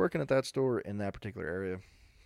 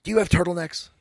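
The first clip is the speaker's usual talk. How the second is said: loud, higher demanding question; storming-in customer; 5 semitones higher, 9 dB louder than usual. Retold or imitated. Customer storming up to new clerk, loud demand. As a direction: demand it loudly as an impatient shopper. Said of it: "This lady comes storming in".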